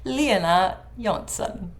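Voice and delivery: careful Swedish attempt at "Lena Jonsson", slowly; "Lena" with a gliding ee-eh, "Jonsson" starting with a y sound; Swedish pronunciation. Shown as a demonstration. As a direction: say "Lena Jonsson" slowly as LEE-eh-na YOHNT-son. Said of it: "really hard for me to actually do it".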